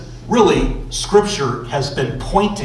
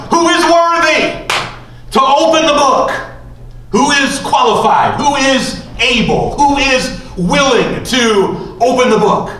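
angel shouting; 9 semitones higher than usual. Acted. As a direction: cry it out like a mighty angel to all heaven. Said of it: "a strong angel comes and starts shouting".

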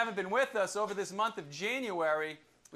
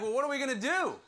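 higher, louder panicked cry; panicked crew; 7 semitones higher, 5 dB louder, faster than usual. Retold or imitated. Sailors in the storm asking what to do, panicked. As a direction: throw it up in alarm like frightened sailors. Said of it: "So they're like".

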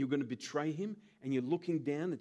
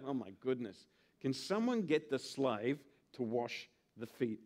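a put-on entitled guest voice, lower and softer; snooty guest; 6 semitones lower, 4 dB quieter than usual. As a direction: play the pampered guest waiting to be served. Guest expecting slave, acted quote.

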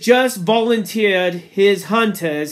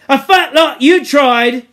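loud, higher sneer; contemptuous; 7 semitones higher, 10 dB louder than usual. Acted. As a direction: scorn him with every word. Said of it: "said Jack contemptuously".

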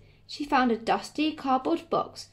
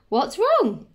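alarmed question; 8 semitones higher, 6 dB louder than usual. A narrator's reading. Grandma responds to a wailing baker, high and alarmed. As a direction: pitch jumping up, louder and urgent. Grandma answers Jack's panicked wail.

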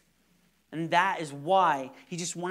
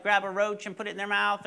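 ordinary talk, nothing marked

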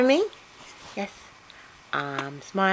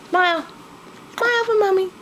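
very high, louder singsong coaxing; 5 dB louder, faster than usual. Real, not acted. Mother coaxing her baby, very high baby talk.

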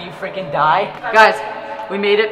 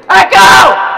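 loud high shout to test echo; 11 semitones higher, 13 dB louder than usual. Real, not acted. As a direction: belt it out to hear it bounce.

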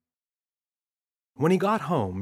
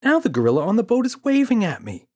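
put-on excited child; 11 semitones higher, 7 dB louder, faster than usual. Boy pestering dad.

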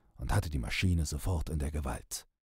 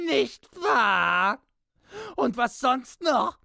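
very high, screaming rage; raving; 13 semitones higher, 4 dB louder than usual. A narrator's reading. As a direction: rave and curse at the top of your voice. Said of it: "Piepsam tobte noch immer".

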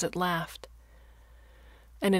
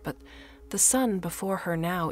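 plain reading voice

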